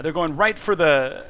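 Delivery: usual speaking voice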